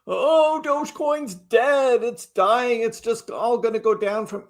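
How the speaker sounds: high-pitched, drawn-out mock despair; mock doomsayer. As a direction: imitate a panicky complainer, pitched well up. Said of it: "some of you are like".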